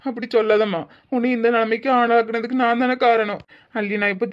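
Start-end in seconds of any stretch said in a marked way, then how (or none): none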